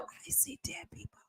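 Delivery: breathless unvoiced whisper; spooky whisper. Host whispers a spooky film line about a creepy hotel. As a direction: whisper it like a ghost movie line.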